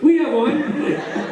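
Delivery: high, stretched-out mimicking call; eager audience members. Audience members volunteering a story, high drawn-out call. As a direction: mimic a crowd calling out eagerly. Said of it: "like, ten people in the audience".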